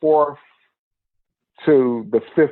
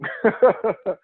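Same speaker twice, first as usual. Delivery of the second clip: high laugh; 7 semitones higher than usual. Real, not acted. Laughing about long movie.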